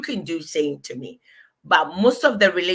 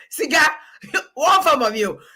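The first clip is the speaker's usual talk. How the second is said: high, loud, slowed-down mock outrage; playfully indignant, pitched up and loud; indignant protest; 7 semitones higher, 4 dB louder than usual. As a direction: act offended over a snack. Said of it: "I'm like".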